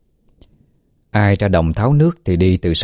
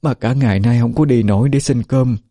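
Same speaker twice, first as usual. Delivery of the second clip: weak, higher-pitched mother's plea; pleading mother; 4 semitones higher than usual. Acted. Sick mother begs the old man's pardon for her feverish child, pleading. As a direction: apologise haltingly in a higher voice.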